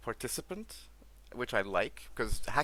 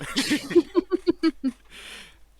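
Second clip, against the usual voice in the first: high laugh; 10 dB louder than usual. Real, not acted. Laughing at his own bad delivery.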